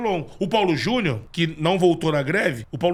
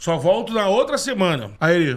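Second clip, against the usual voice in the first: normal talking voice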